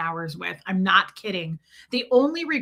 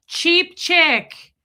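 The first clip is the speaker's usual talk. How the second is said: put-on voice; 8 semitones higher, 7 dB louder than usual. Playful nickname for a viewer.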